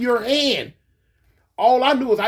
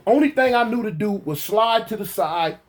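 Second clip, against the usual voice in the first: ordinary talk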